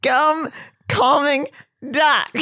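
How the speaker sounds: each word said high and separately, 'Gum calming duck'; slowly and carefully untangle 'Gum calming duck'; careful retry. Shown as a demonstration.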